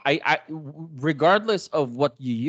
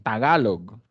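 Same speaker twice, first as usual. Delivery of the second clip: TAGALOG said the Spanish way, each vowel full and clear; Spanish accent; 2 semitones lower than usual. Shown as a demonstration. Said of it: "if you say that in Spanish".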